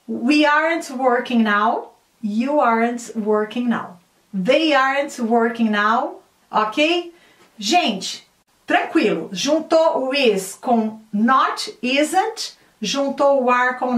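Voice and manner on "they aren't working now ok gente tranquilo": usual speaking voice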